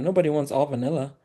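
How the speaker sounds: ordinary talk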